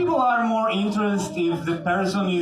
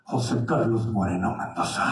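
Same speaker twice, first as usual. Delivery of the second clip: telenovela villain; 9 semitones lower than usual. Acted. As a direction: growl it like a soap standoff. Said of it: "one of them goes like".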